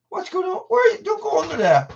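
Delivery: very high, loud call to the dog; squeaky high call to the dog; excited dog talk. Real, not acted. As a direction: pitch way up to coax the dog. Dog wanders under the table.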